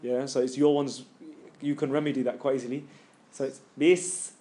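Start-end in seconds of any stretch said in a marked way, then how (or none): 3.8-4.3 "this" said as "bis", ending in a long hiss; short b, then sustain the s in "this"; held s. Shown as a demonstration. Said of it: "Don't let your tongue go".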